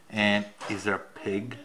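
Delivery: usual speaking voice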